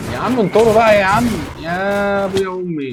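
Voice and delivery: high, loud pleading yell; exasperated cry. Real, not acted. Gamer yelling at mobs to stop, exasperated.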